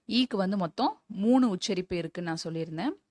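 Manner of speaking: ordinary talk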